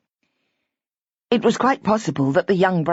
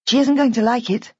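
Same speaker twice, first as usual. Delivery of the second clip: warning; 6 semitones higher than usual. A narrator's reading. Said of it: "she warned them".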